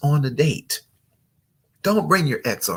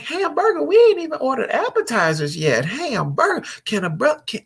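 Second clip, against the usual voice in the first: high-pitched mock exasperation; strained, high protest over the crying; exasperated date; 14 semitones higher, 4 dB louder than usual. Man to his crying date before appetizers, exasperated.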